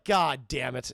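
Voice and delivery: low, grudging mutter; resigned cursing. Retold or imitated. Streamer voicing Bruce hearing about his parents, resigned. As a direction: curse in mock reluctant defeat.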